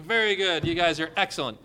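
ordinary talk

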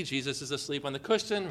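normal talking voice

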